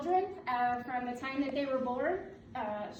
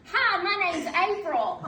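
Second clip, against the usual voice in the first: imitating a thick Southern accent, louder; a playful, louder Southern accent; mock Southern accent; 6 semitones higher, 10 dB louder than usual. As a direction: put on a broad Southern accent to tease a friend. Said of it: "in the worst Southern accent".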